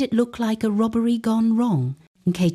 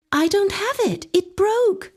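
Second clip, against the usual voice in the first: panicked denial; 13 semitones higher than usual. Acted. Terrified woman lying to gunmen.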